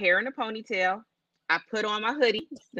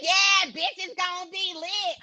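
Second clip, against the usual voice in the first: put-on drunk friend; 7 semitones higher, slower than usual. Voicing drunk friend Crystal.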